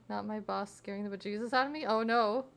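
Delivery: normal talking voice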